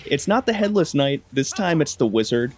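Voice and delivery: ordinary talk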